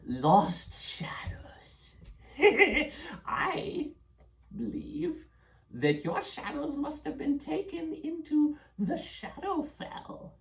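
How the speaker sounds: put-on high old witch reading, slow and breathy. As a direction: muse like a hag over her book. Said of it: "she's like".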